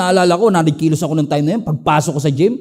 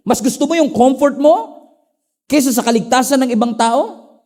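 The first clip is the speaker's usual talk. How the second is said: God rebuking; 6 semitones higher than usual. Acted. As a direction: press Jonah with each question.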